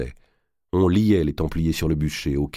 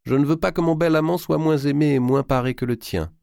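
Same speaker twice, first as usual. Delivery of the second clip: eager exclamation; 10 semitones higher than usual. A narrator's reading. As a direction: cry it out, piqued and eager. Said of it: "s'écria Blanche".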